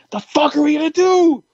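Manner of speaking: very high, frantic squawk; panicked yell. Retold or imitated. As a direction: re-enact total panic, voice shooting way up. Acted panic after the injury.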